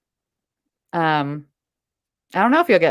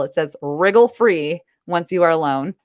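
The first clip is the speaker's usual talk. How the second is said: usual speaking voice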